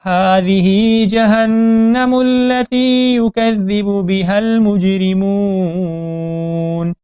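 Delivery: slow melodic tajweed chant; Quran recitation. Shown as a demonstration.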